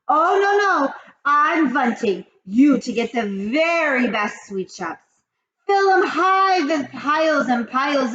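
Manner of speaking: high, loud witch rant. Acted. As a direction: rage like the Grand High Witch. Grand High Witch speech.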